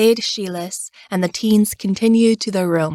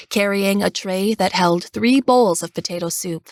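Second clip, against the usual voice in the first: plain reading voice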